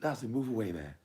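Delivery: high-pitched film character; high put-on voice; Smokey. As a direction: imitate a high-voiced brush-off. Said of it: "He's like".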